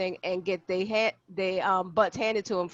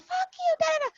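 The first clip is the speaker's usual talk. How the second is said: put-on bratty kid. Kid cursing in TikTok.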